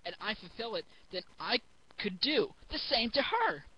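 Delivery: ordinary talk, nothing marked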